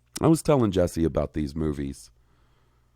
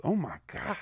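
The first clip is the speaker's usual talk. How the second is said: anxious groan; 11 semitones higher, 9 dB quieter than usual. Real, not acted. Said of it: "You are really giving me the, the anxiousness".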